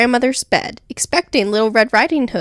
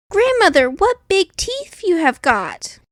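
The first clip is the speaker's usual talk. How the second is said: put-on high, wondering little girl; a girl marvelling at her granny; the girl in bed asking her grandmother, high and amazed; 6 semitones higher than usual. A narrator's reading. Girl voiced in story.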